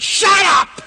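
high, explosive yell at a seatmate; sudden shout. Retold or imitated. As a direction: burst out with a loud, high shout. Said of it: "I said".

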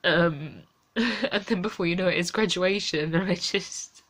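quiet, tearful and worn out; exhausted, close to crying, barely above a murmur; near tears. Real, not acted.

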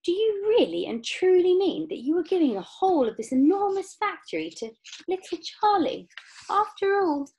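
high, loud, astonished grandfather; incredulous, pitched well up; stammering disbelief. A narrator's reading. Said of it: "stammered Grandpa Joe".

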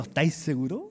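lightly voiced skeptical God; God's dry reply. God answering the eager believer, a touch amused and quiet. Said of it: "Y Dios está diciendo".

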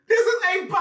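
shrieking with joy at the song; excited shout. Real, not acted. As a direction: yell it out in delight. Album reaction, track drops.

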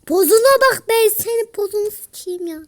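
loud, high angry swearing; angry rant. Real, not acted. Gamer raging at a taunting opponent, angry. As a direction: vent the fury at the enemy. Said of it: "Kusura bakmayın arkadaşlar, sinirlerimden ya".

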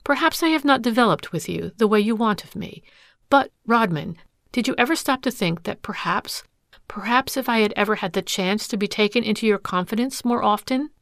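usual speaking voice

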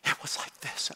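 voiceless whisper, no tone at all; voice gone. Shown as a demonstration. Pastor showing the congregation how his lost voice sounded, a toneless whisper. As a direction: show how the voice just disappeared. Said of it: "my voice went out".

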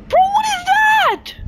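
slow high shocked cry; stunned and scared. Real, not acted. Shocked at mob.

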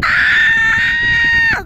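panicked shout to get away; scared yell. Real, not acted. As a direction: yell in real fright. Scared yelling at game creature.

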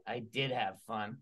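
low, slow, soft puppet voice; Magenta puppet. Acted. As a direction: admit it quietly as Magenta. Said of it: "Hi, Magenta".

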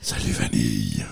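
deep, hollow ghoulish voice; low, creepy beyond-the-grave growl. Shown as a demonstration. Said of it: "C'est ma voix d'outre-tombe".

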